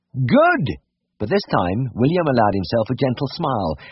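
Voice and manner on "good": fervent good. A narrator's reading. William's fervent reply.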